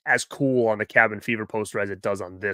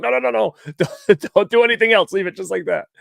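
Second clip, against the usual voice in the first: higher and louder, hurried pleading no; frantic no, don't touch it; urgent stop; 9 semitones higher, 4 dB louder than usual. Retold or imitated. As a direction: rush to stop him before the pen moves. Stopping signer from adding date.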